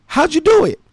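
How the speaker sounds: high-pitched imitation of eager friends; mock amazement. Retold crowd asks in amazement.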